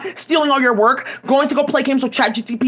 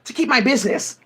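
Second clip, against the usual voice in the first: ordinary delivery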